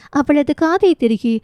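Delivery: usual speaking voice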